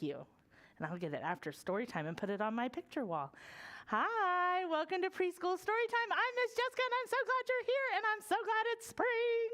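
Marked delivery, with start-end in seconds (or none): 3.9-9.5 put-on high, bright welcome to little kids; storytime host voice. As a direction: greet preschoolers like a children's show host. Opens story time to kids.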